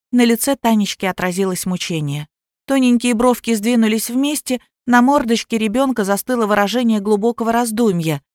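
ordinary delivery

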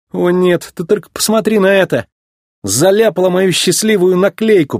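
high-pitched dismayed grumble; grumbling. A narrator's reading. McQueen finds tar on his lucky sticker, grumbling. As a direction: complain with whining dismay. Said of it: "проворчал Маккуин".

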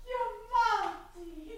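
soaring, drawn-out cry of astonished joy, slow; slow, high cry of disbelief and joy; overjoyed surprise. Acted. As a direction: she can't believe her son is at the door. Son unexpectedly arrives at door.

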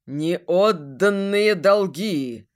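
slow, hollow ghostly moan; voice from beyond the grave. Acted. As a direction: drag it out like a ghost. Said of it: "загробным голосом сказал Войс". (загробный голос)

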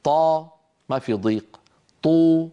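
طا and طو said with clear open vowels; voweled taa. Shown as a demonstration. Teacher sounding out taa syllables for the class. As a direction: sound out each syllable distinctly. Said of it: "نجرب في حرف الطاء".

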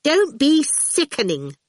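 slow, high-pitched disgusted retort. A narrator's reading. Awful answers Miss Potter's gushing, scornfully retorting. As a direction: throw it back, high and scornful. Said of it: "Awful retorted".

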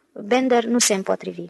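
usual speaking voice

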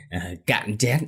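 tipsy chuckling toast; carefree drunken laughing. A narrator's reading. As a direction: laugh and ramble like a happy drunk. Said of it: "cứ cười nói vô tư". (cười nói lè nhè say rượu)

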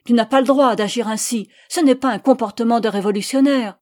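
loud, indignant rebuke; indignant shout. A narrator's reading. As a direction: cry out against an injustice. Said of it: "Elle lui cria indignée". (cri indigné)

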